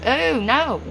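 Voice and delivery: high startled oh no; shocked refusal. Real, not acted. Reacting to a viewer's toe request, startled. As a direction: recoil at the request.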